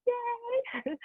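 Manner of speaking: high, squealed little cheer; celebratory cheer. Real, not acted. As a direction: let out a proud, high-pitched yay. Cheers son's college graduation.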